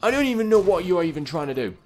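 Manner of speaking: high, loud yelling in disbelief; frantic shouted complaint at the game; exasperated. Real, not acted. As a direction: demand answers, voice leaping up. Characters keep moving, nearly glitching game.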